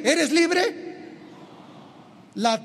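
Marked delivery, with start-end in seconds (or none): 0.0-0.9 scornful. Retold or imitated. Scornful question after mocked advice.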